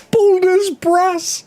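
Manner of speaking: very high, drawn-out and loud; gleeful squeal. Acted. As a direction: squeal the joke title, cracking up. Repeats joke title, cracking up.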